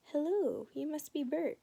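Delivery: high, loud muppet-style greeting; Sesame Street voice. Acted. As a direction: play a puppet, bouncy and high. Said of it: "his best Sesame Street voice".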